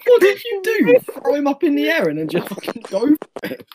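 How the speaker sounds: incredulous. Real, not acted. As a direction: squeal the question in disbelief. Laughing disbelief at story.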